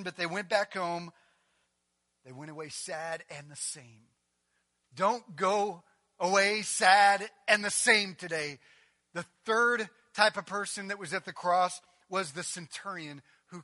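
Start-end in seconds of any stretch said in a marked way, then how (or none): 4.9-8.6 slow, loud, each word pressed; slow pulpit appeal; slow emphatic plea. Acted. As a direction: urge them not to leave unchanged.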